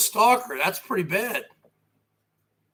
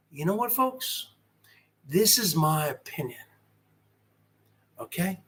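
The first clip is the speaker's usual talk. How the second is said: very soft, low-pitched, measured delivery; quiet talking; 5 semitones lower, 10 dB quieter than usual. Shown as a demonstration. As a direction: drop right down to a hushed, low voice. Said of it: "I can go from talking like this".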